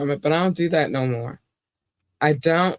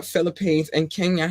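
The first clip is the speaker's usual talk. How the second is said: ordinary delivery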